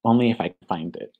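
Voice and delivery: usual speaking voice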